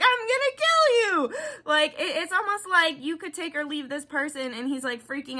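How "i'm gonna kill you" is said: high, squeaky mock threat; cartoonish high threat, jokingly furious; mock menacing partner. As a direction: play the miserable guy hammed-up and high.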